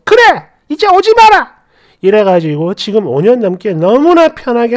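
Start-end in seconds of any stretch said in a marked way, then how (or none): 0.0-1.6 high-pitched, huffy mock yell; sulky outburst. Sulking mother-in-law banishing her daughter-in-law, huffy yell. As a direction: imitate an offended elder lashing out. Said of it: "나중엔 삐져가지고".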